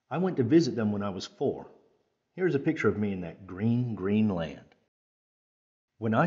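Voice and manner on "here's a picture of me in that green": ordinary delivery, nothing marked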